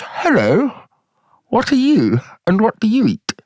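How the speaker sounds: high, swooping cartoon frog voice; wide mouth frog. Frog greets a giraffe in a joke, high swooping voice. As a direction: squeal it wide-mouthed, pitch leaping up.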